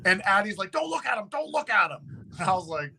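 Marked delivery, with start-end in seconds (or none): 0.7-1.3 urgent warning. Retold or imitated. Addie urging him in the car. 1.3-2.3 urgent warning. Retold or imitated. Addie urging him in the car.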